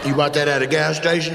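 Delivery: usual speaking voice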